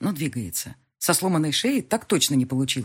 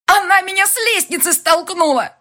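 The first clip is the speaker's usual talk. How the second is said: higher, louder furious accusation; angry shout; 14 semitones higher, 9 dB louder than usual. A narrator's reading. Elvira shouting accusation to Barkhanov, angry. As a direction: shout it out in anger. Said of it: "зло выкрикивает".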